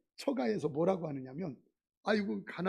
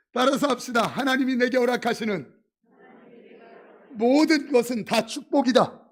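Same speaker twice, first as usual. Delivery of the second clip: call to repeat; 3 semitones higher, 11 dB louder than usual. Shown as a demonstration. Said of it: "따라하기 힘들면".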